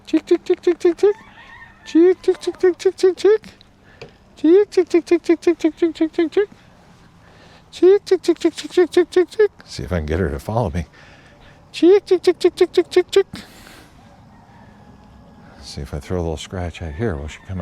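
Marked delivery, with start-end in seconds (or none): 0.0-9.5 high, loud, repeated chick-chick call; calling chickens. Real, not acted. 11.7-13.2 high, loud, repeated chick-chick call; calling chickens. Real, not acted.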